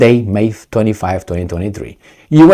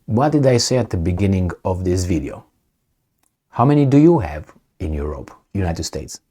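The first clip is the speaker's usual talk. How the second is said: normal talking voice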